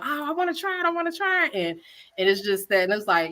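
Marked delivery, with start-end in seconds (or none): 0.0-1.5 high, keen imitation of customers; eager fans. Chef imitating excited customers wanting tamales, high and eager. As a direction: mimic people begging to taste. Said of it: "everybody's just so excited".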